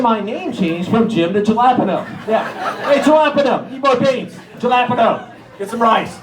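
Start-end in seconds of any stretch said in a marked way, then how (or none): 2.9-6.2 loud, much higher calls across the kitchen; calling out. Retold or imitated. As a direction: shout the nickname at the new guy. Coworkers calling out the new nickname.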